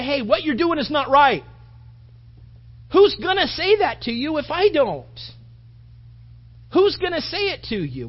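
confronting. Acted. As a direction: call them out firmly, voice lifted. Pastor acts out confronting a wrongdoer.

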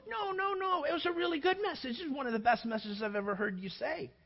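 high, eager reassurance; gushing friend. Retold or imitated. Friend insisting the bad sermon was great, high and gushing. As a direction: protest too much, sweetly.

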